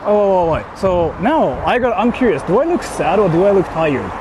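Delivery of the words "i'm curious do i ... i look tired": usual speaking voice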